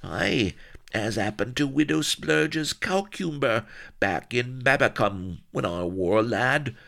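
high-pitched country yokel reminiscing; old rustic Solomon. Acted. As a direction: ramble in a rustic West Country voice.

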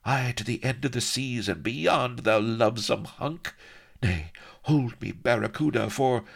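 high, coaxing sultry woman voice; seductive pirate queen. Acted. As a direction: purr at him like a lovesick pirate queen. Sheba woos Avery.

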